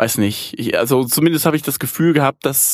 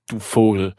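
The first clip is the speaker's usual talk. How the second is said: ordinary talk, nothing marked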